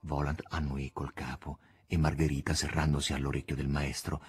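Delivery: usual speaking voice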